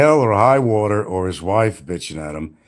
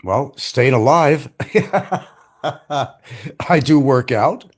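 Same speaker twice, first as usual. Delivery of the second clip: ordinary talk, nothing marked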